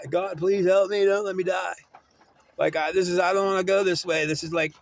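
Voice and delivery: a touch of a terrified plea; retold panicked prayer. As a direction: beg God not to let you die, half-acted.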